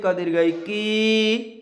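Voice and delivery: "की" drawn out on a long ee; long vowel. Shown as a demonstration. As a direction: hold the final ee of "की". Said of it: "थोड़ी सी खींच देंगे".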